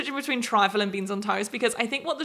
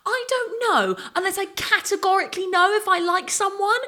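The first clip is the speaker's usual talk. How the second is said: higher, put-on imitation of Molly-Mae; imitating Molly-Mae; 10 semitones higher than usual. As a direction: mimic her fussy, breathy insistence. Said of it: "she always used to go".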